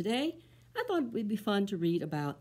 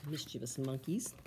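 ordinary delivery

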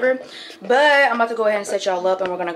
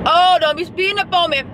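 calling out a driver; 7 semitones higher than usual. Real, not acted. Telling off a speeding driver.

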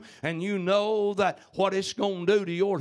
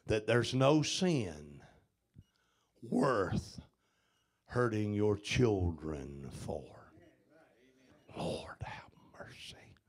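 softer, breathy whispered plea; grave, softer whisper fading to nothing; 9 semitones lower, 7 dB quieter than usual. Acted. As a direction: hush the room with a whisper. Hushed grave sermon moment.

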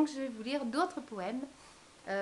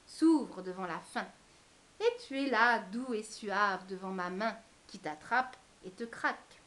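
expressive recital in short phrases with pauses; poem reading; 4 dB louder than usual. Acted. Poet reading an ode to chocolate to viewers, expressive recital. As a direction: recite it with emphatic openings and falling line ends. Reads own poem aloud.

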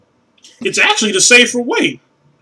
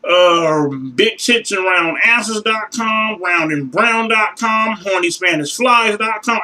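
slow listing; 3 semitones higher, slower than usual. Real, not acted. Listing porn sites slowly.

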